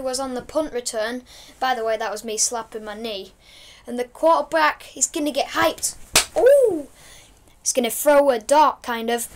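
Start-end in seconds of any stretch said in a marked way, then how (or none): 6.4-6.9 high loud ooh of delight; excited ooh. Real, not acted. Gamer hyped at his quarterback, loud ooh. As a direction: let the ooh jump up.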